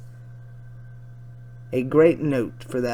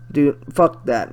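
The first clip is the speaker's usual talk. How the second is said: ordinary talk, nothing marked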